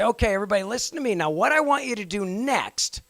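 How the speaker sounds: high, projected classroom announcement; teacher voice. Shown as a demonstration. Teacher starting instructions to get students listening, high projected teacher voice. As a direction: call out over chattering students. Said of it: "you can begin your conversation and say".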